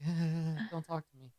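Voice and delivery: very soft, barely there mutter; dismissive drugged man; faint slurred brush-off; junkie mumble. Acted. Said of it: "the guy leaning against the wall is like".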